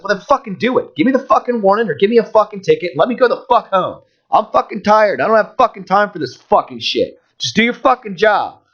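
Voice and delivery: high, exasperated rant at normal volume; retold angry screaming. Driver retelling cutting off the cop's warning, exasperated. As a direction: re-enact a tired driver losing it, pitch way up. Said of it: "when I started fucking screaming at".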